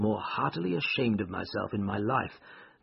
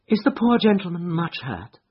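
higher-pitched lady asking after someone; concerned lady's question; 7 semitones higher, 4 dB louder than usual. A narrator's reading. Irene asks the crowd about the injured man, concerned lady's question. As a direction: ask in a raised woman's voice. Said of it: "she asked".

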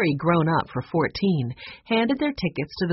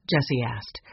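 usual speaking voice

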